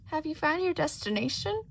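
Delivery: high, sly cat voice; Cheshire Cat voice. Acted. The floating cat teasing Shota, high and sly. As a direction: tease like a grinning cat.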